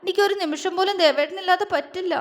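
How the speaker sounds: wife sobbing that she cannot live without him; sobbing plea. A narrator's reading. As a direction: beg him never to leave. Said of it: "അവൾ തേങ്ങിക്കൊണ്ട് പറഞ്ഞു".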